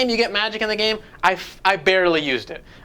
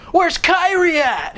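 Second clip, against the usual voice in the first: high, slow shouted question; mock character shout; 11 semitones higher, 4 dB louder, slower than usual. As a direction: yell it like a cartoon hero.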